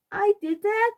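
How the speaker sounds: put-on high, loud child's question; curious toddler. A narrator's reading. Fudge asking Peter about the mess, high curious child voice. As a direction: ask it wide-eyed like a little kid.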